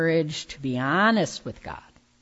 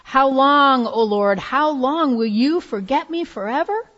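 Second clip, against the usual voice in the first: loud, high pleading cry; anguished cry; 8 semitones higher, 5 dB louder than usual. Acted. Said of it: "The psalmist did it".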